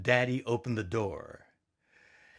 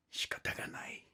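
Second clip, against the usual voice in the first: resigned whisper of shikata ga nai, much softer; 12 dB quieter, faster than usual. Acted. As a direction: breathe shikata ga nai in a hush. Resigned reply through wall.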